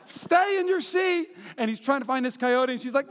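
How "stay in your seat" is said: high, stretched-out mock order; imitated scolding parent. Parent to son unbuckling in the car, mock scolding. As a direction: draw out the command, pitched up.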